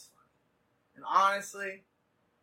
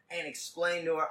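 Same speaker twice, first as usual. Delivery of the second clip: ordinary talk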